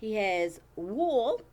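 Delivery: usual speaking voice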